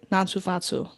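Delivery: a thick Boston accent on Natsu Fatsu. Shown as a demonstration. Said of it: "it's like a Boston accent".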